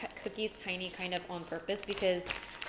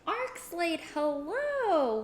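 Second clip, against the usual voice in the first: excited greeting; 8 semitones higher, 5 dB louder, much slower than usual. Real, not acted. Greeting raider on stream.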